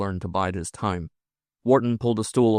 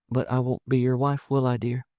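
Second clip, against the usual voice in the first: higher, tender woman's voice; doubtful woman; 5 semitones higher than usual. Acted. Woman unsure she will be his wife, gently doubtful.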